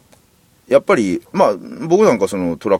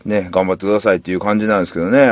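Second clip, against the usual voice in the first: ordinary talk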